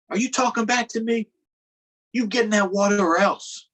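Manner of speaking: put-on bully the child into the water; mean threatening boss. Farmer threatens young Harriet into the water, mock angry.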